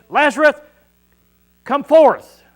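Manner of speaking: full-voiced call, high and loud; loud command. Jesus at the grave, calling Lazarus out loudly. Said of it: "with a loud voice".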